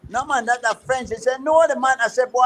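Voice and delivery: ordinary delivery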